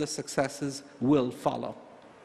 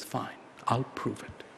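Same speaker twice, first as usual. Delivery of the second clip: whispered, softer bunny reply; a soft confident whisper to the lion; 9 semitones lower, 7 dB quieter than usual. Acted. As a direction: breathe it out as the clever bunny. Bunny answers lion quietly.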